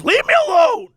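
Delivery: high, loud outburst; shrieked-up, furious mock yell; mock shouting. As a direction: imitate a customer exploding in rage. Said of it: "just by shouting at people".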